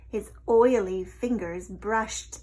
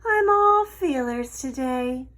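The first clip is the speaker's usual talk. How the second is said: mock dismay; 4 semitones higher, 7 dB louder than usual. A narrator's reading. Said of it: "she said with mock dismay".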